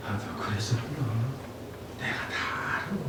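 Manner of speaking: hushed, almost whispered comfort; barely voiced, soothing words of understanding; tender reassurance. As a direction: comfort them very softly, as if close by. Voicing the Holy Spirit's comfort.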